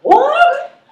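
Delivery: high, stunned double take; incredulous what. Acted. Mabel reacting to the hotline list in disbelief. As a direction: ask it twice in disbelief.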